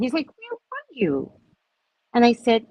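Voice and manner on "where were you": high, slow and worried; a hint of an anxious date; genuinely concerned. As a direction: ask it as if really scared for her. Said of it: "genuinely concerned".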